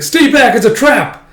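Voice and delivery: high, loud urgent yell; shouted warning. Acted. Wounded fighter warning his friends of a trap, urgent shout. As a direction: yell a warning to your comrades. Wounded man warns the others.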